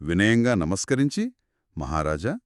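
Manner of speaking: usual speaking voice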